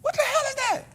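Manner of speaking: high, shouted startled outburst; jolted awake and yelling in confusion. Retold or imitated. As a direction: pitch leaps up in alarmed disbelief. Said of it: "I'm like".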